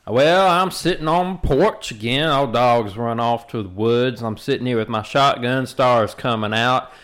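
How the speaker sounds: put-on slow, satisfied old Southern farmer on his porch; stately old Southerner. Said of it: "the stately country, like, Southerner".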